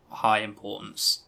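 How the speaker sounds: usual speaking voice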